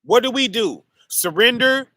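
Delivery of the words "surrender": usual speaking voice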